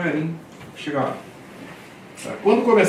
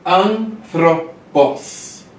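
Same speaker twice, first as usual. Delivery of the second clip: slow model reading; 3 semitones higher, much slower than usual. Shown as a demonstration. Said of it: "slow model reading of word".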